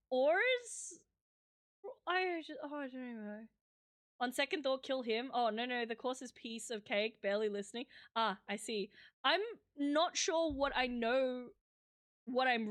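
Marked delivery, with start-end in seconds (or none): none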